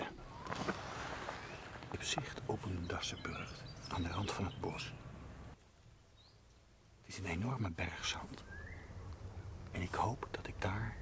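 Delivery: low, very soft field commentary; hushed. Real, not acted. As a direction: keep it down near the badger sett. Watching a badger sett at dusk. (gedempt, zacht en laag)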